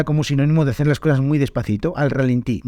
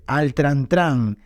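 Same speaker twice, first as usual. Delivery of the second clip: slow, careful spelling-out; much slower than usual. Shown as a demonstration. Reads out the unfamiliar expression.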